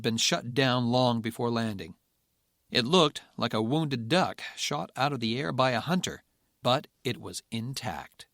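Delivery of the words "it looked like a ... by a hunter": plain reading voice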